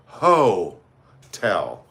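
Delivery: "ho-tel" split slowly in two, hard on the "ho"; stress on HO. Shown as a demonstration. Host mocking Megan's hotel business, "ho-tel" broken with heavy stress on "ho". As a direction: draw out "ho" in "ho-tel", pause, then drop "tel". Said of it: "emphasizing the word".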